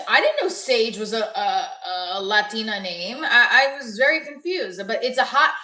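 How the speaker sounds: ordinary talk, nothing marked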